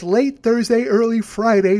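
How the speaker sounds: talking through a yawn; stifling a yawn. Real, not acted. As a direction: hold back a yawn mid-sentence. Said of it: "I just talked right through it".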